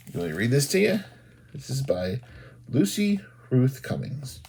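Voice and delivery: ordinary delivery, nothing marked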